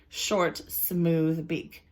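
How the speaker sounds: Short, smooth beak said very slowly, each word its own beat; slow, even alliteration. Shown as a demonstration. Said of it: "words next to each other that have the same first".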